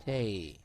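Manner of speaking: "Tay" drawn out alone, the middle of state without its S; sound demo. Shown as a demonstration. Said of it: "the next word is state".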